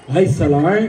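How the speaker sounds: low, punched mock film-star delivery; mock Amitabh acting. Shown as a demonstration. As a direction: overact it low and loud, as the wrong way. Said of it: "तसलं अजिबात नाही". (नक्कल करत खालच्या आवाजात नाटकी acting)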